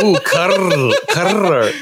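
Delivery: Carl moaned with a rolled r; breathy moan of Carl, rolling the r. Pretend. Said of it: "rolling your R's for Carl when you're moaning it".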